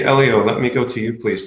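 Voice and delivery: usual speaking voice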